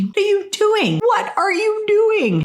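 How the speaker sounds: high, slowing alarmed cry from the brother; an alarmed big brother rushing in. Retold or imitated. Brother bursting in on his sobbing sister, alarmed and high. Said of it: "he says to me".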